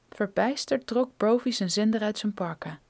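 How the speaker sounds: ordinary delivery, nothing marked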